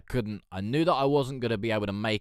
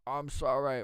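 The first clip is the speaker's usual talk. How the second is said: panicked apology; 6 semitones higher than usual. Retold or imitated.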